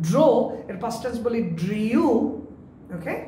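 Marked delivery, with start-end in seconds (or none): 1.6-2.1 drew said as DREE-yoo; common mispronunciation. Shown as a demonstration. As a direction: put a drawn-out y glide into drew. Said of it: "এগুলো প্রত্যেকটা ভুল". (drew ভুলভাবে ড্রিউ)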